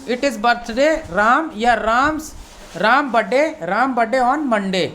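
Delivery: very slow, careful teacher reading; slow model sentence. Shown as a demonstration. Teacher reads the example sentence slowly to students. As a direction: spell it out word by word. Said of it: "देखो बोलने का English में कैसे बोलना है".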